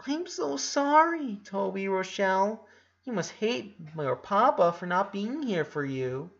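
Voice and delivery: put-on baby voice. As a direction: coo to the egg like it's a baby. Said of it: "putting the same baby voice he had".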